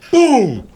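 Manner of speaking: a sudden loud, high "boom"; imitated gunshot boom. Telling his friends the gun went off, shouted boom. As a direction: blast it out like the shot going off.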